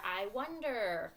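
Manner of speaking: drawn-out musing; slow, acted curiosity; thinking voice wondering. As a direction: ponder it slowly, as if thinking aloud. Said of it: "our thinking voice says".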